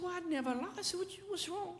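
very soft, high coaxing; hushed, high fatherly concern, acted out; reassuring parent. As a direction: reassure a little child in a small lifted voice. Dad reassures his upset daughter.

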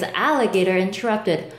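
ordinary delivery

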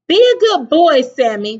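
high, loud motherly send-off; crying out. A narrator's reading. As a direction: call after a departing child. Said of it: "cried his mother".

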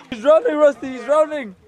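high, loud panicked yelling; panicked shout. Acted. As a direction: yell for help in a panic. Mock panic over game.

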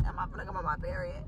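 very high, soft and quick; hushed aside. Retold or imitated. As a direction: voice a private thought, squeaky and small. Quotes own puzzled thought aside.

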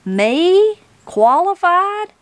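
high, drawn-out disbelieving questions; astonished. A narrator's reading. Granny challenged by a judge on the bench, astonished. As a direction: echo the word in high-pitched amazement.

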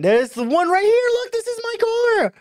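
high excited cry; excited shout. Real, not acted. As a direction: shout with glee at finding it. Sees own car.

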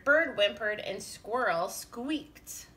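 ordinary delivery

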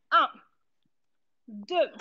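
ordinary talk, nothing marked

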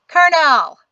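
loud high call to stop him. A narrator's reading. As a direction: shout his title across the arena. Trum shouts to stop him.

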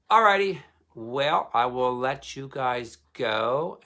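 high, loud, drawn-out goodbye; big sing-out farewell to the audience; upbeat sign-off. Real, not acted. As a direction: wrap up brightly and loudly, stretching the words. Cheery sign-off to viewers.

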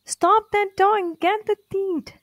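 high shouted order; king shouting. A narrator's reading. King shouting at the crowd to catch the dog, high and loud. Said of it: "shouted the king".

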